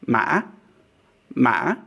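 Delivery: "ma" broken by a glottal stop, then rising sharply; hiccup tone. Shown as a demonstration. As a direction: put a catch inside "ma" and jump up. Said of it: "I'm calling this the hiccup tone".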